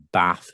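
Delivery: "Bath" with a short northern a. Retold or imitated. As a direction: say "Bath" with the vowel of cat. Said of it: "that thing that's more northern".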